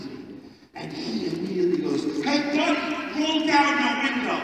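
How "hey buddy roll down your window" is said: high, calling voice of an angry driver; hammed-up road rager; mock aggressive driver. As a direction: yell it across lanes at a red light. Road rage driver calls over.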